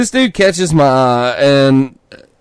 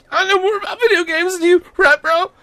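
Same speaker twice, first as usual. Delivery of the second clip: much higher, smug know-it-all bro voice; smart-ass bro. Retold or imitated. Mocking the smug clerk, high know-it-all bro voice. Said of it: "that smart-ass look of, like".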